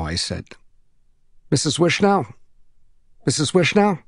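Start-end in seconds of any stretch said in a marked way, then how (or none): 1.5-4.0 put-on higher child's voice, calling for help; boy calling out. Trapped boy calling the neighbor, higher and pleading. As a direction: call through a door like a stuck boy.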